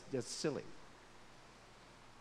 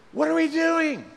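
exasperated outburst; 13 semitones higher, 11 dB louder than usual. Real, not acted.

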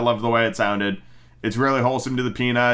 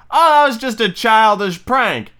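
high, louder lackey brushing it off; Smee voice; 9 semitones higher, 5 dB louder than usual. Retold or imitated. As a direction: play the sidekick shrugging it off. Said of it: "And Smee's like".

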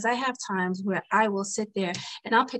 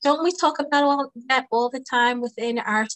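normal talking voice

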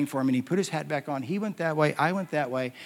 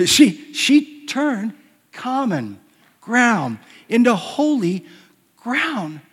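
higher, louder and slow, leaning on every word; emphatic preaching; 7 semitones higher, 5 dB louder, much slower than usual. Acted. Pastor hammering his theme to the church, emphatic. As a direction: drive the phrase home to the congregation.

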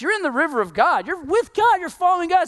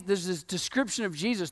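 ordinary talk, nothing marked

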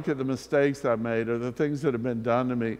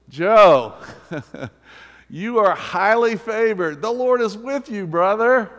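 much higher and louder, beaming; joyful calling out; 8 semitones higher, 8 dB louder than usual. Acted. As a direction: call across the room to a friend with delight. Preacher calls out a listener by name.

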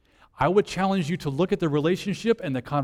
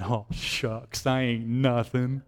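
lower, softer, bashful mumble; aw-shucks bashful; 4 semitones lower, 4 dB quieter, slower than usual. Pretend. Preacher mocking Jesus as falsely modest, bashful and soft. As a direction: play it like a shy kid scuffing his feet. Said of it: "Jesus kinda kicked the water and said".

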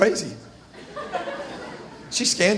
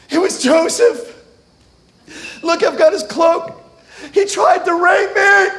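mock tearful, higher and louder accusation; false accusation; 5 semitones higher, 3 dB louder than usual. Potiphar's wife accusing Joseph to guards, mock tearful. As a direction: fake the outrage of a victim.

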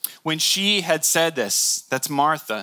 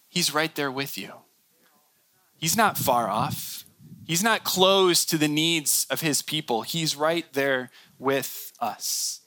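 reassuring; 4 dB quieter than usual. Real, not acted. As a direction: gently reassure the listeners. Answer after pleading questions.

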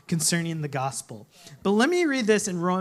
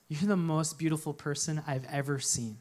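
soft tender reply; 5 semitones lower, 7 dB quieter than usual. Acted. Daughter's moved reply to mom.

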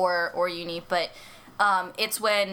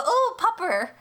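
gushing dog greeting; 8 semitones higher, slower than usual. Acted. Greeting a dog on sight, high gushing coo. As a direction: fuss over a dog in a raised voice. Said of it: "when I see a dog, I go".

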